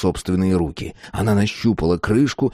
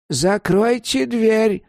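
put-on high, drawn-out old woman's hiss; hissing impatiently; hissed order; 13 semitones higher, much slower than usual. A narrator's reading. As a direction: shush him with a slow, high-pitched command. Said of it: "шикнула она мистеру Берри".

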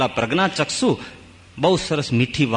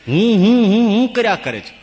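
mocking; 7 semitones higher, 4 dB louder than usual. Mocking people's constant "I, I.

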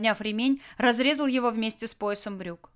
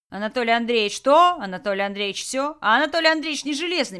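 louder, mock-nagging then faster complaint; self-pitying mimicry; 5 semitones higher, 9 dB louder than usual. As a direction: whine about everyone's demands. Tolyan mimics demands on him.

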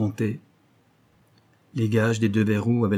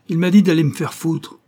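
loud, raised, taunting reply; defiant retort; 7 semitones higher, 7 dB louder than usual. A narrator's reading. Simon defiantly lying to the policeman, loud. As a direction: throw the lie back at them.

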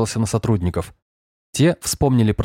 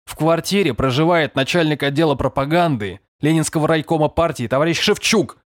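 higher, emphatic official retort; curt rebuttal; 6 semitones higher than usual. A narrator's reading. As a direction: shut down the argument. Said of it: "отрезал Сергеев". (отрезая, с начальственным нажимом)

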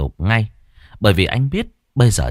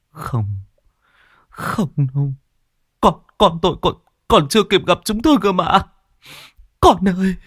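grief; 8 semitones higher than usual. Acted. Father learns baby died.

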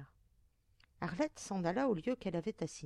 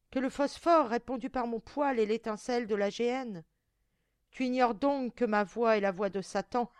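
loud, raised, scornful tirade; strident demon voice; 4 semitones higher, 8 dB louder than usual. A narrator's reading. Damned monster refusing pity, loud strident scorn. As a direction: thunder scorn at a begging victim. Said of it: "de sa voix stridente et brisée".

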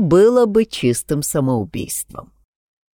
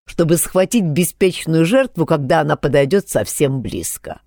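ordinary delivery

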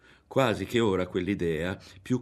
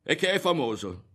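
high, loud, insistent protest; heated, pitched-up denial; overwrought; 10 semitones higher, 5 dB louder than usual. A narrator's reading. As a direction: insist as if swept away. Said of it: "una ventata lo sconvolse".

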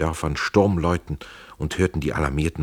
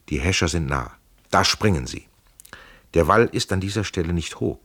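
plain reading voice

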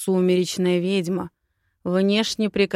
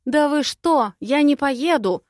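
high, loud, blurting it out; blurted refusal; 8 semitones higher, 7 dB louder than usual. Acted. Heroine blurting out her refusal, loud and high. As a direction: burst out before anyone can answer. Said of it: "выпалила".

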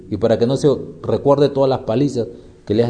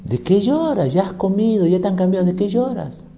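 higher-pitched retold telling-off; mild mock exasperation, pitched up; mild parental scolding; 6 semitones higher than usual. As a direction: question a crying baby, lightly acted. Retold scolding of crying baby.

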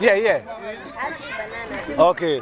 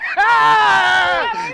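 loud burst of laughter; 11 semitones higher, 5 dB louder than usual. Real, not acted. Father laughing as the child misspells.

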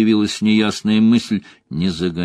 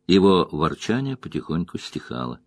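ordinary delivery

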